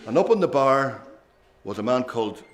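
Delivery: usual speaking voice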